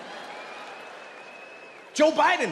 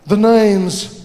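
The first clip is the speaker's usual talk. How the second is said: suave spy; 3 semitones lower, 8 dB louder, slower than usual. Acted. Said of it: "Idris Elba walking over".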